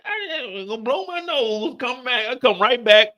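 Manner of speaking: ordinary talk, nothing marked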